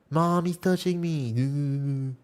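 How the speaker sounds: put-on slow whiny kid voice; drawn-out tattling child whine; whiny tattling little sibling. Mock childish line to chat.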